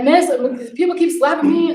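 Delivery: complaining student. Retold or imitated. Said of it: "he's like".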